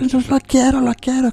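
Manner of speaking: high whiny tantrum voice; whining child. Retold or imitated. Spoiled child demanding a toy, high whine. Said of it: "gritar y patalear".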